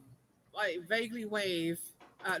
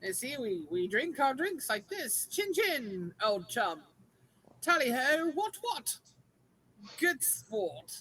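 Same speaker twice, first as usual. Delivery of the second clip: hammed-up posh British; slower than usual. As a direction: ham up an old-school English toff. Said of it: "do your posh British voice".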